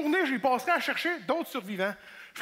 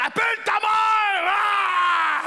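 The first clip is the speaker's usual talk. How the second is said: high, loud bellow ending in a roar; macho refusal; 8 semitones higher, 6 dB louder, slower than usual. Acted. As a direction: yell it to shut the talk down. Old-time man refuses to talk.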